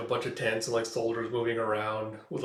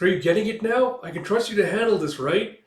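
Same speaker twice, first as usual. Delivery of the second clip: higher, louder mocking calls; taunting shout; 8 semitones higher, 6 dB louder than usual. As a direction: jeer at him from a distance. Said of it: "It's like".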